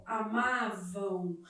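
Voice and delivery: "amavam" slow, low and soft; demonstrated diphthong. Shown as a demonstration. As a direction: draw out the final nasal glide in "amavam". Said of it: "Aqui eu tenho um ditongo".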